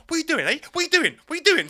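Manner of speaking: high, loud repeated challenge; schoolboy squaring up in a scuffle, high loud yell; mock aggressive yell. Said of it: "It went from".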